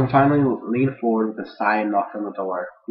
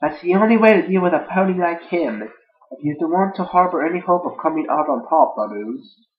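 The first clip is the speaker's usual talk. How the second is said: high, loud put-on voice; filly character voice; 7 semitones higher, 4 dB louder than usual. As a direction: play a grand filly, pitched way up. Voicing the same filly's line.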